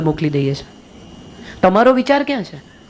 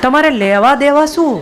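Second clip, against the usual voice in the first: scolding rhetorical question; 6 semitones higher, slower than usual. Real, not acted. Scolding gossiping women.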